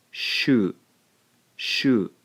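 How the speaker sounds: "shu" as one syllable; one clean beat for "shu"; blended shu. Shown as a demonstration. Teacher showing learners the blended syllable. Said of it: "we end up with".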